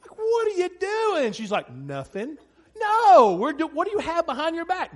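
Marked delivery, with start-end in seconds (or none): 0.2-1.3 put-on high, loud questioning of a child; acted parental challenge. Dad catching daughter with scissors, high loud challenge. 1.7-2.4 low little answer, rising at the end; mock innocent denial. Daughter caught by dad, feigned innocence. 2.8-4.9 high, loud burst of disbelief, easing slightly; acted parental interrogation. Dad questioning daughter's hidden hands, high loud challenge.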